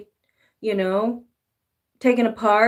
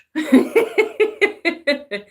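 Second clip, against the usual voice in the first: high laugh; 8 semitones higher, 6 dB louder than usual. Real, not acted. Laughs after cursing punchline.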